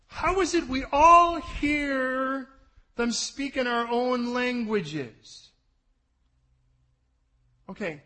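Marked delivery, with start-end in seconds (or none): 0.1-5.5 high, loud, slow proclamation; preaching climax. Real, not acted. Preacher reading the crowd's question to the church, loud and slow. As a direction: proclaim it like the crowd's astonished cry.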